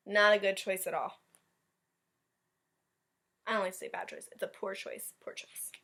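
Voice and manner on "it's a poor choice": usual speaking voice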